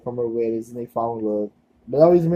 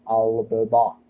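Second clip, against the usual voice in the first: put-on amateur Terminator catchphrase, Austrian accent; bad Austrian accent; 3 semitones lower, slower than usual. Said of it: "I know that's a bad Australian, no, no, Austrian accent".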